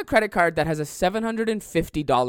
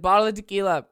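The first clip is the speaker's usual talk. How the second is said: louder, higher mock call-out; imitated yell; 5 semitones higher, 6 dB louder, faster than usual. Customer shouting his order to the server, imitated yell. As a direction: call out the drink order, half-yelled. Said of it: "I yelled tequila the loudest".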